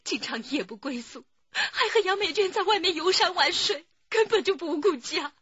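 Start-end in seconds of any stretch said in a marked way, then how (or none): 1.5-5.3 bursting out about the unfaithful husband; wife airing her bitter grievance, raised and loud; upset complaint. Acted. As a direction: voice climbs higher and louder in grievance.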